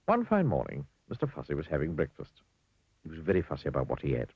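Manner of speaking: usual speaking voice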